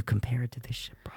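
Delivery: whispered aside; whispering. Real, not acted. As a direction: drop to a whisper. Sudden hushed aside about producers.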